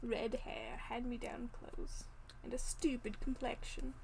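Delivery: soft, sneering whisper-quiet put-down; hushed sneering quote. Retold or imitated. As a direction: murmur it with a sneer. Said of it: "quoting Draco's sneer at Ron".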